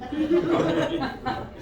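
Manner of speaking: slow words broken up by laughing. Real, not acted. Lecturer teasing dentists about missed sleep apnea, laughing. Lecturer cracks up at own joke.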